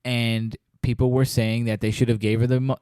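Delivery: ordinary talk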